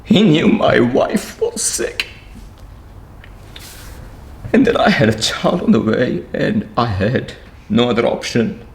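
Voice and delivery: real emotion catching in the throat; voice breaking. Real, not acted. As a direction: hold back tears as it all comes out. Breaks down telling his hardship.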